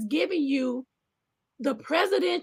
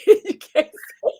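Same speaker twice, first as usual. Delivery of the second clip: laughing whisper; 6 semitones higher, 8 dB louder, slower than usual. Real, not acted. Reacting to a slavery joke.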